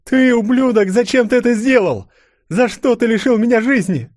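high, loud enraged tirade; furious accusation. A narrator's reading. Mother turns on Gromov who took her life, furious. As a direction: hurl it at him, high and loud.

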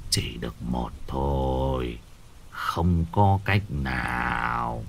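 put-on very low, slow, soft old man; grim refusal. Shaman refusing the mother's plea, low and slow. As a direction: say no slowly, heavy and final.

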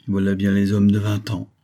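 ordinary delivery

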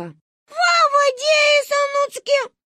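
put-on very high babyish lisp; a tiny girl mangling words proudly; lisping toddler. Sonechka answers uncle Misha: very high lisping toddler voice.

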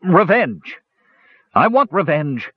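mildly hammed-up villain's outburst; vengeful. A narrator's reading. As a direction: declare it with contained fury. Villain's furious thought of revenge.

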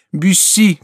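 high, loud cry for rescue. A narrator's reading. Diana is dragged away from Bussy, high cry. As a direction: call his name in alarm. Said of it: "кричала Диана".

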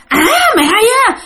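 very high, quick menacing retort; retold threat. The ghost hears mother's defiance before pouncing, high quick threat. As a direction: imitate an affronted ghost, pitch shooting up. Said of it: "bả nghe vậy cái bả nói".

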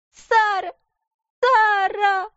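put-on very high childish calling out; crying child calling. A narrator's reading. Little girl calling her friend for comfort. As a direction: call out for help, whimpering.

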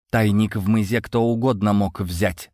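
ordinary delivery, nothing marked

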